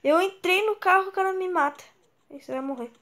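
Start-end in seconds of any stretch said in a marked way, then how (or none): 0.0-1.8 high indignant outburst; being chased. Real, not acted. Killed while fleeing. (indignado por ser morto)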